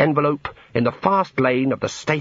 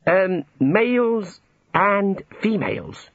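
slow, higher-pitched venture; tentative; 8 semitones higher, much slower than usual. A narrator's reading. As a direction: inch awkwardly through the explanation. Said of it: "he ventured".

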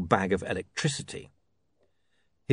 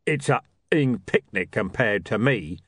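rumbling. Acted. Mister Tulip threatening Charlie. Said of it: "rumbled Mister Tulip".

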